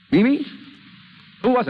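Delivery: high, loud snap of her name; sharp call. Acted. Nick rounds on Mimi at the dinner table, sharp loud call.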